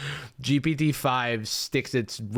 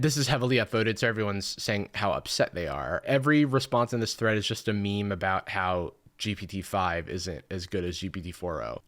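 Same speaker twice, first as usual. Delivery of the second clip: usual speaking voice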